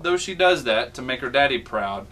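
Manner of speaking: ordinary delivery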